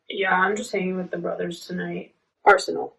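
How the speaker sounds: normal talking voice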